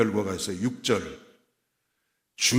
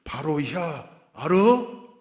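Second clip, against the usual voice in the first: drawn-out Chungcheong dialect with a rising question; unhurried Chungcheong accent, lifting at the end; 4 semitones higher, much slower than usual. Acted. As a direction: imitate a slow-talking Chungcheong speaker. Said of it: "충청도 말로 말하면".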